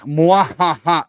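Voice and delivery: slow, loud mock evil cackle; villain laugh. Gloats over rival's saved bullet.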